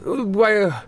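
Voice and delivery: high posh waiter voice; waiter greeting. Acted. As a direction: greet them high and prim like a butler. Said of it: "and they go".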